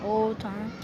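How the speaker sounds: ordinary talk, nothing marked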